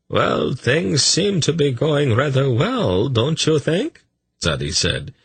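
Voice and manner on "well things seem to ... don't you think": high, slow, pleased-with-yourself drawl; self-congratulatory. A narrator's reading. Tzadi rubbing his hands together, self-congratulatory. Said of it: "in a self-congratulatory manner".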